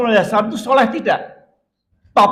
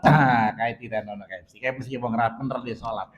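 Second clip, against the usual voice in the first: lower, muttering mock of a judgmental neighbor; mock gossip; 10 semitones lower, 4 dB quieter than usual. Preacher mimicking a judgmental neighbor's gossip, lower and mocking. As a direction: play the gossip who blames the victim.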